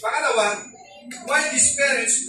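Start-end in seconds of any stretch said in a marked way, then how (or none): none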